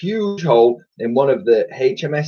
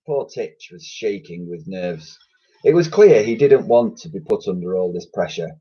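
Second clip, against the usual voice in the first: usual speaking voice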